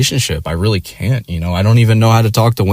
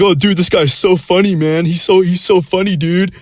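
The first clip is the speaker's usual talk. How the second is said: much higher, gushing bro voice; mock giddy coworker; 10 semitones higher than usual. Mocking his coworker gushing about the comic in a high voice. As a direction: parody a starstruck buddy.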